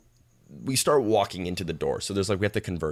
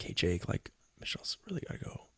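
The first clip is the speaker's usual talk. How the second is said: quick hushed plea; whisper; 13 dB quieter than usual. Retold or imitated. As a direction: imitate a discreet, urgent whisper.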